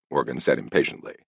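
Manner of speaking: plain reading voice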